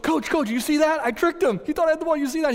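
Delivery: high, loud, breakneck excitement; excited boy bragging. Retold or imitated. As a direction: burst out to the coach, thrilled. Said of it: "I was so excited".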